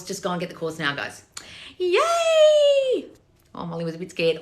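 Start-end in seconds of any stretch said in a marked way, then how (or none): 1.8-3.1 high excited yay for the dog; squealy cheer to dog. Real, not acted. Cheering the nervous dog on with a squeal.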